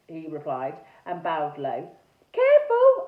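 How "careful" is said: sudden high, loud cry; shouted warning. A narrator's reading. Araminta warns Sir Horace as he bows, a high shout. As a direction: shout out in alarm. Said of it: "I shouted".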